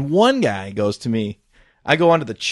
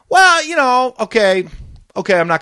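put-on young pilot; 10 semitones higher, 5 dB louder than usual. Retold young pilot hedging his answer.

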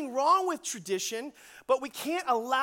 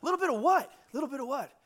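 mock alarm, pitched up; mildly freaking out; 3 semitones higher, faster than usual. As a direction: imitate teens starting to worry. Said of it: "They're, like, freaking out a little bit".

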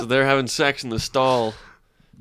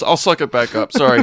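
ordinary talk

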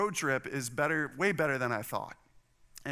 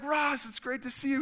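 high, exuberant greeting voice; hammed-up hearty welcome, pitched way up; outgoing father-in-law; 10 semitones higher than usual. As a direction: imitate an overjoyed in-law saying hello. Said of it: "he's a pretty outgoing guy".

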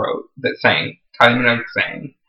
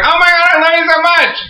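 much higher, squealing gush; fangirl gush. Retold or imitated. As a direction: gush at your idol. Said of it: "I was like".

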